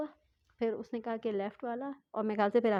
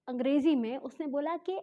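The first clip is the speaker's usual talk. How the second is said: ordinary delivery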